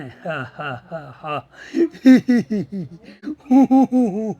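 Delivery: loud, rolling villain laugh; slow long laugh. Shown as a demonstration. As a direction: lean back and laugh long. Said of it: "એમ ઈ ધીમે ધીમે હસો".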